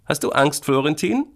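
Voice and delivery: high, rising question; questioning big sister. Acted. Sister asks brother if he is afraid, high-voiced question. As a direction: ask in a high girl's voice.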